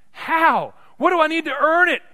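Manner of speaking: high, loud preacher's cry; impassioned questioning. Acted. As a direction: cry out as the astonished believer. Acts out a believer's question.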